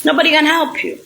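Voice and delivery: ordinary delivery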